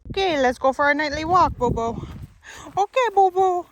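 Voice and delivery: high, loud, eager call to the dog; excited dog talk. Real, not acted. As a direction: pump the dog up for a walk.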